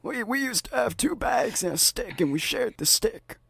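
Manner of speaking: put-on high creaky old man; reminiscing old geezer. Shown as a demonstration. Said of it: "Or an old man voice".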